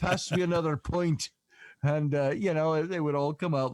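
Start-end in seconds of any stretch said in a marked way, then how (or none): 0.0-1.3 Irish brogue, pint in hand; rowdy pub Irish accent; drunk Irish brogue. Retold or imitated. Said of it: "our voices would start to go".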